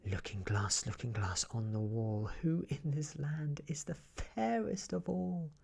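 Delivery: lightly voiced queen questioning; a hint of the queen's voice. Queen asking her mirror, lightly voiced and proud. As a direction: ask the mirror with a touch of pride.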